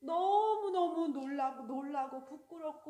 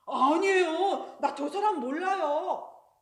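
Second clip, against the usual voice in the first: much louder, slow, insistent denial; 2 semitones higher, 10 dB louder, slower than usual. Acted. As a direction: push the denial away, loudly and slowly. Peter denies knowing Jesus.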